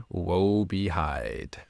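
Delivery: very slow, mock doom; ominous doom. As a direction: drag it out like a curse. Mock doom after gasp.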